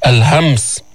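ordinary talk

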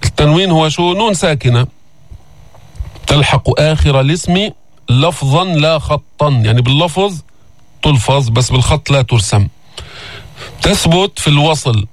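usual speaking voice

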